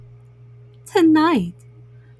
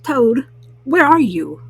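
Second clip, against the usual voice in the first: calling out; 4 dB louder than usual. Acted. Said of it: "called Frog".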